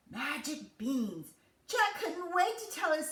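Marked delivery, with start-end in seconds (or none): none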